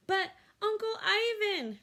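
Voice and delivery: put-on high, slow pleading child; Ruby pleading with Ivan. A narrator's reading. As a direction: beg Uncle Ivan. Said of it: "Ruby pleads".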